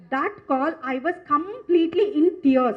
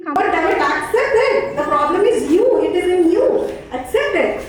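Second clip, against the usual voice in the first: louder, laughing self-reproach; self-scolding with a laugh; 3 semitones higher, 5 dB louder than usual. Retold or imitated. Mother scolding herself to accept the problem, laughing. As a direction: tell yourself off, half laughing.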